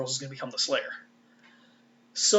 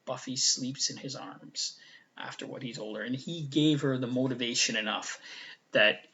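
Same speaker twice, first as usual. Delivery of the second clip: usual speaking voice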